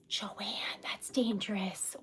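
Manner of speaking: breathy gasped whisper; whispering in shock to Joanne. Real, not acted. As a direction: hush it out in awe. Gasps at viewer's typewriter news.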